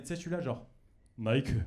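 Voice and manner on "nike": mock Japanese anime delivery; brand name in a put-on Japanese accent; Japanese-style voice. Shown as a demonstration. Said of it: "mais en mode japonais".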